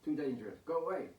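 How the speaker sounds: high, shaky plea from hiding; frightened priest warning them off; weak frightened voice. Acted. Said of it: "a weak voice".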